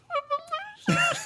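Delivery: very high-pitched whimpering sob; hammed-up drunken weeping; mock crying. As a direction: sob like someone crying into a pillow. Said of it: "just full on cry myself to sleep".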